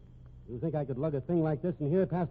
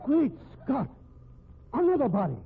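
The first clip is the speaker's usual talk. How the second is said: shocked exclamation; 9 semitones higher than usual. Acted. Man sees a corpse in the crate, shocked cry. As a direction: blurt it out, aghast.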